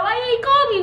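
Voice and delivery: high, hurt disbelief; indignant question. Retold or imitated. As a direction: ask like a let-down friend. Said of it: "He was like".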